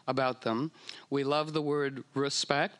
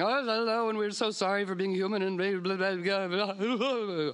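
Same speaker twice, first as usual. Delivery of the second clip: much higher, gushing tourist babble trailing into blah blah; babbling like an idiot; 6 semitones higher than usual. Retold or imitated. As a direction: coo at the whales like a besotted fool. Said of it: "everybody's just babbling like an idiot".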